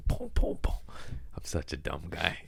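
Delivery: unvoiced whisper, slow. Real, not acted. Whispering to the host about mixing up Abrahams and Abrams. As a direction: mutter it under your breath, slowly. Self-deprecating aside after tank joke.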